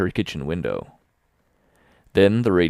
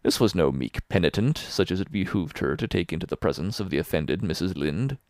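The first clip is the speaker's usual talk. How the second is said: usual speaking voice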